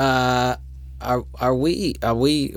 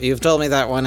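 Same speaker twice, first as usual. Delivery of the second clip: ordinary delivery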